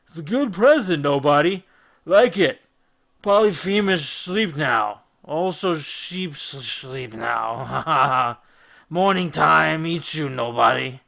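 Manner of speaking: put-on a giant sliding into drunken sleep; drunk Cyclops. A narrator's reading. Polyphemus drunk and sleepy, talking to Odysseus. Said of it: "his words were as scrambled as eggs".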